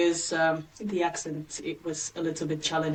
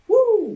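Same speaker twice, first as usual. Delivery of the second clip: high, loud "woo"; long high-pitched cheer; excited whoop; 12 dB louder than usual. Real, not acted. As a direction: whoop with delight. Mock excitement at CSS arriving.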